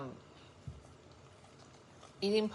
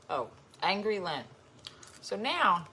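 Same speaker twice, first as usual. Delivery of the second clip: usual speaking voice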